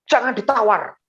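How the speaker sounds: imitated loud, high order; loud scolding. Mall manager forbids the pastor to bargain, loud scolding. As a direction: forbid it forcefully. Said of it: "dibentak sama G M-nya dengan suara yang keras".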